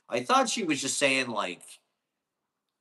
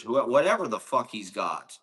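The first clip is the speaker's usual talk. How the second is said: normal talking voice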